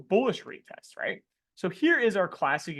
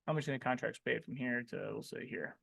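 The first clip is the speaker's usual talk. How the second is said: hushed tease; 6 semitones lower, 12 dB quieter than usual. Real, not acted. Teasing the class, hushed.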